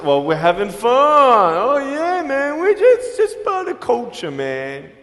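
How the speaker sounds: jeering imitation of kids who think teasing is fun; mocking teaser. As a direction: play the careless bully brushing it off. Mocking people who tease others.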